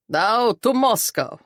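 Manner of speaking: boisterous boss announcing to the party; hearty boss. A narrator's reading. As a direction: proclaim it like a toasting comrade. Boss's hearty toast to couple.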